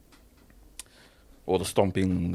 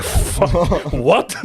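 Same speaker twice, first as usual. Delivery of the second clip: high, louder stunned curse; shocked outburst; 8 semitones higher, 5 dB louder than usual. Retold or imitated. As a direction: blurt it out in disbelief. Shocked at the coach's feedback.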